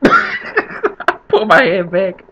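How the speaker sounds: real laughter through the words; loud, high, laughing retelling. Real, not acted. Laughs telling the hand trick.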